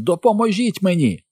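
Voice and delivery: high-pitched yell for help; crying out. A narrator's reading. Henry, knocked down, yells to his gang for help, high cry. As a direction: call out urgently, pitched well up. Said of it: "закричав він".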